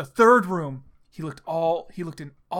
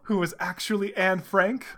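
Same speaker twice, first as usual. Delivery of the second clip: plain reading voice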